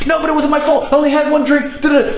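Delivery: high, whiny mock protest; mock whiny girlfriend. Whiny girlfriend excuse voiced.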